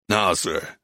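low, courteous tourist; American man. Acted. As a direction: address the elder in a low voice. American man addresses the artist.

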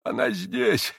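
thin, high, feeble wheeze; old man straining out a weak cry; barely audible high rasp; faint croak. A narrator's reading. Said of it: "Чуть слышно хрипел старик".

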